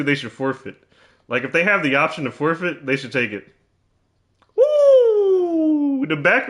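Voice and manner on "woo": high, loud cheering woo; thrilled shout; excited whoop. Real, not acted. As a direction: whoop at a big dunk. Cheering a dunk.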